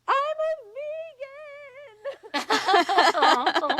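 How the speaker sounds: high, drawn-out wailing yell; mock yell. Distraught driver on the roadside after hitting a deer, hammed-up yell. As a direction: re-enact a breakdown yell, loud and stretched out. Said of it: "all I remember yelling was".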